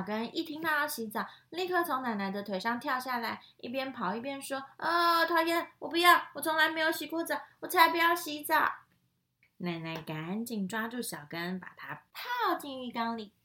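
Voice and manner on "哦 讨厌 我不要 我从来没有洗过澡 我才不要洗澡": high, loud, fast refusal; protesting child. Acted. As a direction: whine it while running away. Fox refuses bath, running off. (大声快速地抗议)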